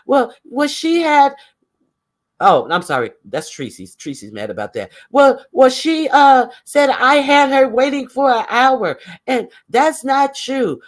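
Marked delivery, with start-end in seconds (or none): 0.1-1.3 high, stammering mimicry; mock whining excuse. Woman mimicked searching for another reason, mock whining. 5.1-10.8 high, put-on stammering grievance; mock whining complaint. Woman mimicked complaining about being accused, mock whining. Mimics M U's next excuse.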